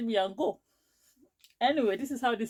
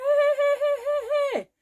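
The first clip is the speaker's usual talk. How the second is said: very high, loud screeched tirade; imitating a shrieking quarrel, far above usual pitch; retold screaming; 6 dB louder than usual. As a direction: screech abuse like an enraged market seller. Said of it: "She started screaming at me".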